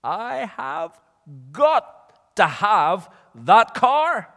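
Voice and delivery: high, loud, drawn-out wanting; desperate craving. Acted. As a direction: insist on it slowly, pitched right up. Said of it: "Do you ever feel like that".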